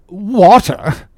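loud, high exclamation; captain's cry. A narrator's reading. Captain interrupting the surgeon's claim, loud cry. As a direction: burst in, scoffing. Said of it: "cried the captain".

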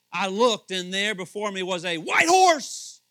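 preacher reading scripture, loud and slow with emphasis; exclamation-point reading. Shown as a demonstration. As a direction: read it like it ends in an exclamation point. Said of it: "It's exclamation point. That's why I'm doing that".

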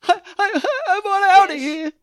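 high, loud hammed-up blubbering, laughing through it; mock crying. Joking about Nick in prison, high mock sobbing. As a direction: whimper like a scared new inmate, hammed up.

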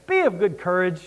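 low, quiet and casual, "Be of good courage" tossed off lightly; a lightly voiced easygoing Paul; soft, offhand mock comfort. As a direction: shrug it off like nothing's wrong. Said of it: "Are you kidding?".

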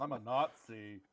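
put-on low, slow botched German accent; wrong German accent. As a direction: slowly fake a German accent. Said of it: "Just do wrong accents for everything".

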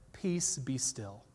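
soft, slow, low command; quiet, slow authority; calm command. Retold or imitated. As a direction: hush the storm gently. Jesus calms the storm.

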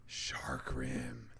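low, unvoiced whisper; hushed whisper. Host echoing the game's pun title, hushed whisper.

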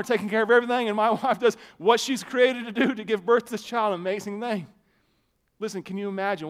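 ordinary talk

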